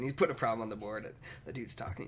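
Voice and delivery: ordinary delivery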